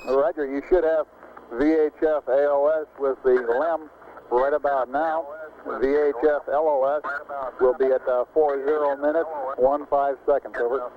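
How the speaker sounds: breaking up space radio. Real, not acted. Mission control reading times over radio.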